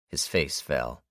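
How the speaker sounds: ordinary delivery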